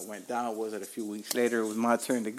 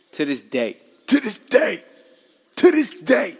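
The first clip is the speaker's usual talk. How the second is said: climbing louder and much higher each time; building shout; 8 semitones higher, 10 dB louder than usual. Real, not acted. Furious about being denied citizenship, he builds to a shout.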